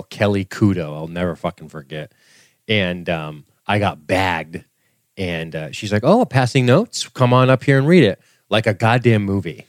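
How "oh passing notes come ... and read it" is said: higher, louder voice of a gloating teacher; mock teacher. Teacher catching him passing a note, higher and louder mock scold. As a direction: call the kid out in front of class.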